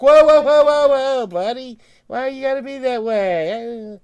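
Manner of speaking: high, loud mock placating whoa; mocking officials. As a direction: ham up a flustered official backing off. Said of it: "they were like".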